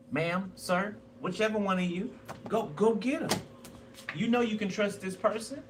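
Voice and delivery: ordinary talk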